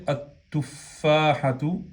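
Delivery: "at-tufahatu" with a doubled t, no L; fused lam. Shown as a demonstration. Teacher contrasting spelling with speech, lam fused into t. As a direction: merge the article into the t of "at-tufahatu". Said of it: "se pronuncia".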